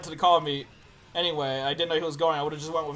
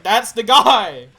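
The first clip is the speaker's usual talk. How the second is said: loud slow accusation; 5 semitones higher, 12 dB louder, much slower than usual. Retold or imitated. Pointing out attacker to cops.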